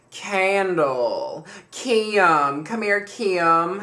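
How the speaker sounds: imitating a Southern mom's drawn-out call; Southern accent. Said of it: "She has this very beautiful Southern voice".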